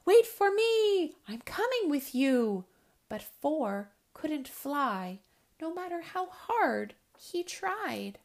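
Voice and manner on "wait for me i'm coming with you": high, loud eager call; crying out. Acted. Small owlet calls after flying siblings, crying out. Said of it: "spread his short wings wide and cried".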